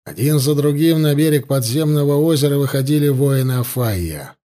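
plain reading voice

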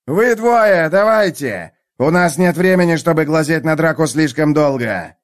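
commanding call to recruits. Acted. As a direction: bark the order across the crowd. Sergeant calling out.

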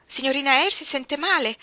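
loud, high, alarmed question; worried maid. Acted. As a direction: burst in asking if the girl is hurt. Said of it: "domandò Bessie". (la cameriera accorre allarmata, voce alta e acuta)

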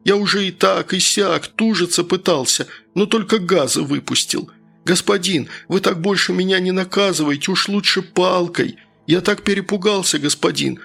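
pleading worker. Acted.

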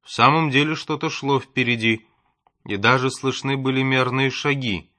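ordinary delivery

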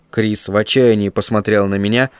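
ordinary delivery, nothing marked

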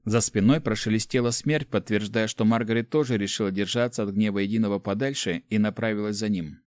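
usual speaking voice

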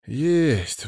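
drawn-out reluctant sigh on the word. A narrator's reading. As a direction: admit it with a sigh. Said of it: "вздохнул я". (со вздохом)

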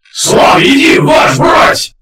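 loud high roar, all breath; roaring shout. A narrator's reading. Dead division roars back to their marshal, shouting. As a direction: roar it like a whole army. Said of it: "хором взревела дивизия".

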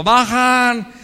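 loud jeering call of "Bajan"; loud schoolyard jeer; taunting shout. Retold or imitated. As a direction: yell "Bajan" like a mocking schoolmate. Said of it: "la expresión que usábamos".

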